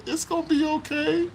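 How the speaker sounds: high, gentle imitation of a little girl; little girl's comfort. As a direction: soothe like a small girl. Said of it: "put her arm around me and said".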